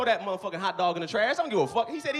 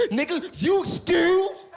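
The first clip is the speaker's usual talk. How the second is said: higher, louder, stretched-out yell with a laugh; mock outraged yell; 10 semitones higher, 5 dB louder, much slower than usual. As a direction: play the offended stranger hollering back, slowly and loud. Angry club guy confronts him.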